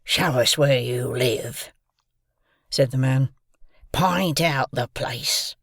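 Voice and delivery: put-on loom over the boy and growl it; menacing convict. A narrator's reading.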